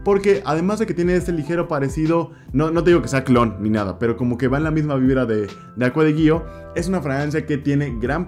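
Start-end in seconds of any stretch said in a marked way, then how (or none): none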